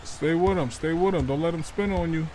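normal talking voice

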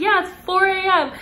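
high, sharp retold yell of annoyance. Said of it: "I yelled at her".

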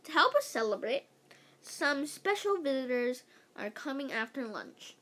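plain reading voice

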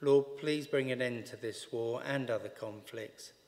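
ordinary delivery

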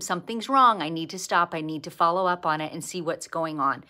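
ordinary talk, nothing marked

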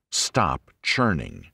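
slowed down, churning stressed; slow repeated refrain. Acted. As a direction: press the refrain home slowly.

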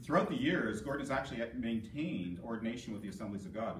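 ordinary delivery

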